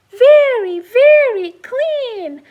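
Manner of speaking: VERY stressed loud, slowly, with a laugh; storyteller stretches very very clean; emphasized very. Acted. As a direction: lean hard on very. Storyteller delights in result.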